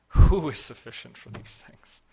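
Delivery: loud, emphatic quoted outcry; retold cry. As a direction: cry it out with a forceful opening. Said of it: "cried out".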